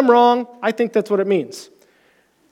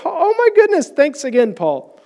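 grateful gush; 7 semitones higher than usual. Retold or imitated. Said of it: "I was like".

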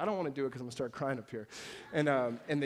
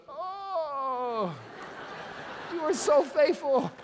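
much higher, slower, hammed-up pious praise; mock gushing prayer; 13 semitones higher, 8 dB louder, much slower than usual. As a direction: overdo the thankful believer.

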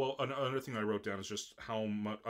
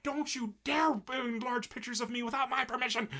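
high-pitched hammed-up scolding; mock indignation; 11 semitones higher, 3 dB louder than usual. Haruhi objects to her enlarged pictures, high mock outrage. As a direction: scold in a raised, comically outraged voice.

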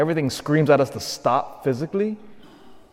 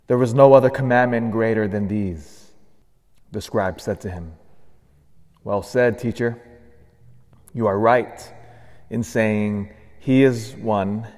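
Gospel proclamation; 5 semitones lower than usual. Acted. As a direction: read it like a liturgical proclamation.

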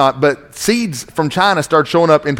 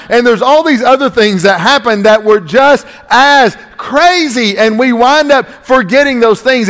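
high, driving sermon delivery; raised, insistent pulpit voice; emphatic preaching; 7 semitones higher than usual. Real, not acted. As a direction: press the point on the congregation.

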